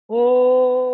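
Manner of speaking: long, loud oh trailing out with the breath; sustained "oh". Shown as a demonstration. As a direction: project oh until out of breath. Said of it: "We're gonna say".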